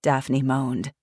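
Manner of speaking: usual speaking voice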